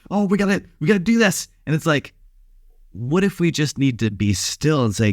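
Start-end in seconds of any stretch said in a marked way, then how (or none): 0.1-1.5 higher, hurried mock panic; frantic busybody. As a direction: play a flustered busybody, pitched up high. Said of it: "We're like".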